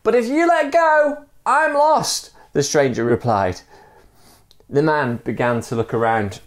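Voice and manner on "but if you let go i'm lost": very high desperate call; high-pitched acted shout; pleading call. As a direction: plead upward from the end of a rope. Dangling stranger pleads from below.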